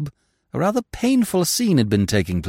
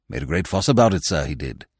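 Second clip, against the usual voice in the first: low servant's voice, dropping away; low, pointed club attendant; reserved attendant; 7 semitones lower, faster than usual. Acted. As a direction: report it with cool deference. Attendant continues his cool report.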